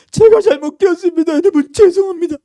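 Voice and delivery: high, loud tearful apology; desperate parent's public apology; pleading parent. Acted. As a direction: beg forgiveness for your child. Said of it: "그러잖아요".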